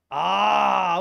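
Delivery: loud, drawn-out "ah"; loud realisation. Real, not acted. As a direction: let out a big ah as it clicks. Sudden realisation on seeing the link.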